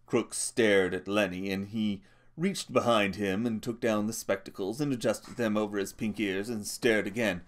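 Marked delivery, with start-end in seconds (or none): none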